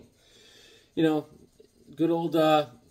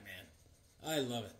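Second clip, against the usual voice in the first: ordinary talk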